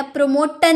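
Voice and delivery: प्रमोटन with a hard "t" in the last syllable; प्रमोटन, the misreading of promotion ending "tun"; wrong T pronunciation. Shown as a demonstration. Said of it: "जो इसे टन पढ़ते होंगे".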